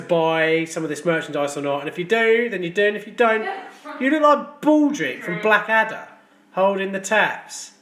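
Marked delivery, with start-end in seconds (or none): none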